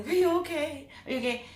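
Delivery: high-pitched, urgent mock concern; imitating worried callers. Friends phoning her after teary video, imitated with worry. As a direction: raise the pitch as a fretting friend checking in.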